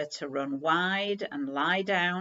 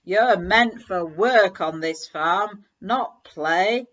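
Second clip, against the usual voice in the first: louder scolding of the dog; stern scolding; 8 dB louder than usual. Acted. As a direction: lay down the law, loud and firm. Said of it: "The farmer's voice was like thunder".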